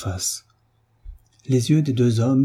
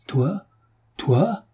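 menaçant; 6 semitones higher than usual. A narrator's reading. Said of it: "le bras levé pour frapper".

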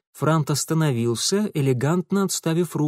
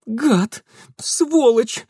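cursing in pain, pitched up; moaned curse; 9 semitones higher, 3 dB louder, much slower than usual. A narrator's reading. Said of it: "простонала она".

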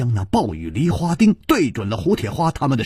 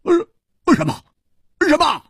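high, loud blurted disbelief; stunned outburst of disbelief; stunned surprise; 4 dB louder than usual. A narrator's reading. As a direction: blurt it out in shock. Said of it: "愣住了，失声说".

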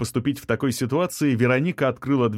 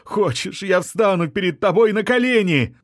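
rising, high plaintive wail; abandoned suitor wailing after her, high and loud at the end; 9 semitones higher, 4 dB louder than usual. A narrator's reading. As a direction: beg her desperately, almost howling. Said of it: "продолжал завывать музыкант".

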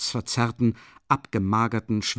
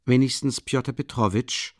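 plain reading voice

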